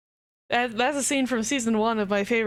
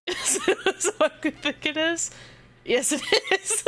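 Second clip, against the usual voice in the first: laughing; 10 semitones higher than usual. Real, not acted. Finds the funny art.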